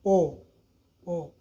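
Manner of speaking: "पो" with a light puff, not "बी". Shown as a demonstration. Teacher correcting students who read b, clear po. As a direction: say "पो", never "बी". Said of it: "तो इसको पढ़ेंगे".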